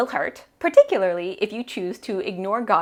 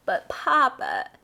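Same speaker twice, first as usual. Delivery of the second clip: slow, high-pitched pleading objection; higher, wheedling daughter; exasperated protest; 6 semitones higher, slower than usual. A narrator's reading. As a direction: drag it out in exasperation.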